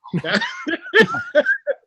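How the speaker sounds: loud, high laughter; laughing. Real, not acted. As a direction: crack up at being teased. Teased about never dunking.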